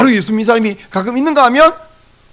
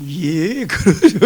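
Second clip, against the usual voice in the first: agreeable Chungcheong person; 2 semitones lower, much slower than usual. Acted. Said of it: "충청도는".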